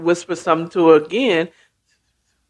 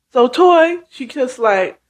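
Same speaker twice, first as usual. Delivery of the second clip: normal talking voice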